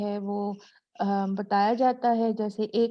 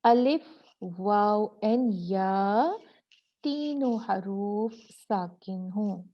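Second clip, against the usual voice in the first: slow emphatic rule; slower than usual. Shown as a demonstration. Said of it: "उसकी क्या शर्त है".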